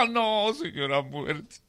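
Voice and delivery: slow, high, half-laughing plea; mock crying plea. Farmer pretends to cry and begs Death, slow and high. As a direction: beg Death through a mock sob. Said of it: "Empezó a llorar el campesino".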